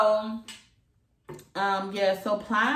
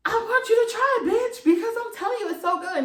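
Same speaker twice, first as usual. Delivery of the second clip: much higher and louder, gushing at her friend; pushing a friend to try it, pitched way up; excited urging; 6 dB louder than usual. Retold or imitated. Telling her good friend to try it, high excited gush. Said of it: "I told one of my good friends".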